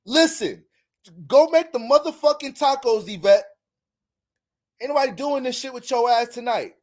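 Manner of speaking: loud, high mock yell at his woman; bossing her around, hammed-up; man barking orders. Boyfriend yelling at Yvette to make tacos, hammed-up angry yell. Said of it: "her man being like".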